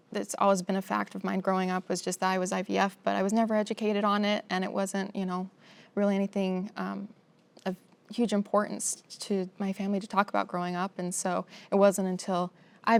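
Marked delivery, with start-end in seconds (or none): none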